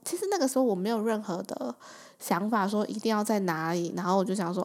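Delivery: ordinary talk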